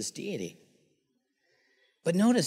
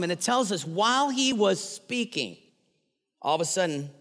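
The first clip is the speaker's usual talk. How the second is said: ordinary delivery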